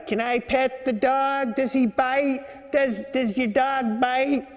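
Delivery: high, slow East Coast woman's voice; East Coast accent. Retold or imitated. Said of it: "that kinda rough East Coast kinda accent".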